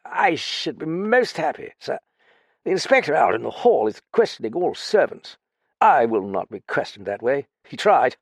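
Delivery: lightly voiced boozy ex-officer; drunk lieutenant. Drunk Graffin holding forth.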